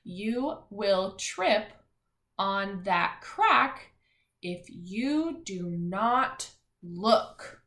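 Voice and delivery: slow, word by word; slow choral reading pace; slow reading aloud. Shown as a demonstration. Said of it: "Let's read it one more time".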